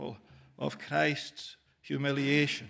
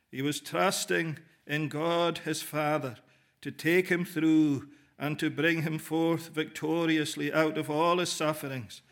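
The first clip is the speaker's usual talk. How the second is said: ordinary delivery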